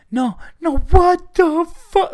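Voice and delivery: high, slow mock wail of a devastated fan; imitating an upset football fan crying out in disbelief. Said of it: "they'll go".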